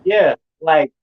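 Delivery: normal talking voice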